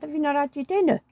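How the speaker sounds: sharp and high with disbelief; mock indignant.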